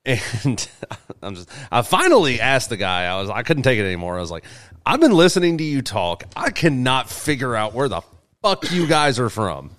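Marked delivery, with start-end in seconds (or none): none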